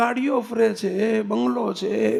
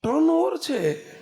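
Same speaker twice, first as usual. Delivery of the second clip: ironic exclamation; 7 semitones higher, slower than usual. Acted. Preacher mocks the son's turnover.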